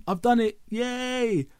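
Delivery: high gleeful yay; excited cheer. Real, not acted. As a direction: squeal it with triumph. Celebrating getting podcast out.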